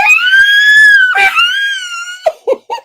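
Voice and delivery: loud, very high squeal of laughter; high shrieking laugh. Real, not acted. Singer greeting Kim with a loud high shrieking laugh. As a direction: shriek with joy.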